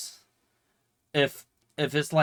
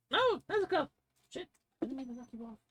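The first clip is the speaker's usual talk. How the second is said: pet talk; 13 semitones higher, 5 dB quieter, faster than usual. Real, not acted. Apologising to a pet she bumped, high soft coo. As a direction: coo it much higher and softer, like to a kitten. Talking to pet or knocked object.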